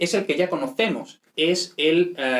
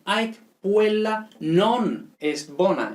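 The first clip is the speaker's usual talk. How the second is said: slow emphatic Latin reading; 5 semitones higher, much slower than usual. Shown as a demonstration. Teacher reads Latin sentence aloud.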